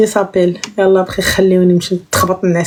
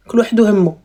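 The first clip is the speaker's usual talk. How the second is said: ordinary delivery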